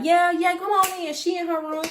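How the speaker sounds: high, breezy careless welcome; a hint of a carefree roommate; lightly voiced roommate. Imagined roommate letting him in.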